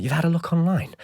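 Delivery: high-pitched disbelieving question; retold scornful astonishment at YOU'VE HAD A LOOK ONLINE; incredulous echo. Incredulous echo of manager's words.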